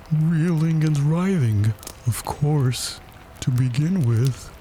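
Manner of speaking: high, slow, solemn voice; melancholy turtle schoolmaster; doleful Mock Turtle. A narrator's reading. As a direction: intone the subjects mournfully.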